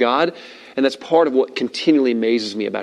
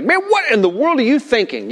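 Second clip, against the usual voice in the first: high-pitched exasperated parent telling off; mock yell at the kids, pitched up; retold yell; 9 semitones higher than usual. Father to his kids after a blunder, retold yelling. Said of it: "I've yelled at my kids".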